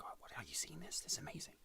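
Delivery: soft awed whisper; hushed. Acted. Agents whispering to each other over the brain waves, hushed. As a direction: whisper in amazement. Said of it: "talking in hushed tones".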